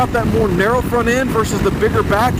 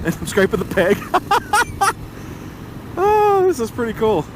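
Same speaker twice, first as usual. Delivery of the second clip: laughing; 4 semitones higher than usual. Real, not acted. Scraping the peg while leaning.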